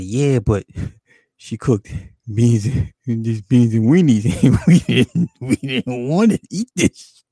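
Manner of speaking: half-laughed retold excuse; laughing through it. Real, not acted. Retelling excuse to angry dad.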